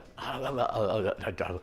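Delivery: babbled stammering noises; exaggerated tongue-tied stutter sounds; mock stutter. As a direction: stammer nonsense syllables, softly. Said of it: "I'm stuttering. I don't stutter".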